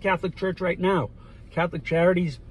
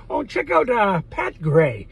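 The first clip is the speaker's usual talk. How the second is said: ordinary talk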